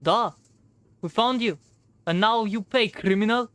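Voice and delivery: put-on Russian-accented character voice. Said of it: "it's the one with the Russian, uh, accent".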